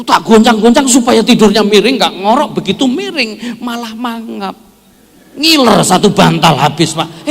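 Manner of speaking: loud, high mock-exasperated husband; complaining husband. Husband loudly complains to the pastor about his snoring wife. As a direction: ham up a fed-up husband's grumbling.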